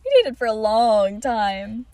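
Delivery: slow, loud, drawn-out words; dramatic confession. Retold or imitated. Telling a stranger about her first love, melodramatic. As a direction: stretch it out, hammed up.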